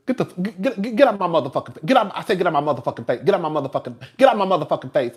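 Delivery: rapid-fire parody of cursing characters; mock cursing tirade. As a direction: rattle it off, tripping over repeats, mocking. Mimicking show characters cursing nonstop.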